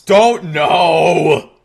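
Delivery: loud, drawn-out protest; exasperated shout. Real, not acted. Streamer begging chat to quit, exasperated shout. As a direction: plead loudly for them to stop.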